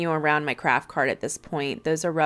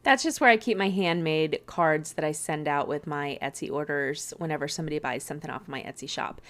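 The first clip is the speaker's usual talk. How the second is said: normal talking voice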